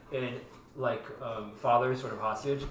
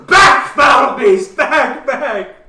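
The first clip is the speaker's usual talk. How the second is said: very loud, high theatrical bellow; hammed-up shout; 11 semitones higher, 17 dB louder, much slower than usual. [name] fending off Adam who fakes infection, hammed-up shout. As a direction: overact it wildly, as if warding off a monster. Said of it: "[name] is clearly hamming up".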